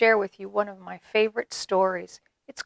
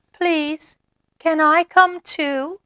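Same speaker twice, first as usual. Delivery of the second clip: small voice; 8 semitones higher, 3 dB louder than usual. Acted. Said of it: "a very small voice".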